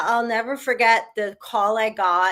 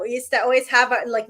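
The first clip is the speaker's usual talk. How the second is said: ordinary talk, nothing marked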